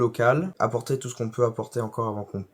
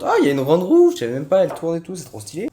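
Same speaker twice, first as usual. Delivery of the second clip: excited surprise; 6 semitones higher, 8 dB louder than usual. Real, not acted. Sees big wheel.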